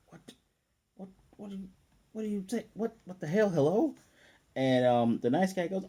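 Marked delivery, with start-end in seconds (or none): 0.1-3.9 high, halting stammer of disbelief; imitated shock. Lloyd taken aback by the girl, mock stunned sputtering. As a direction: sputter from small broken whats up to a high hello. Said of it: "he has his own shock face".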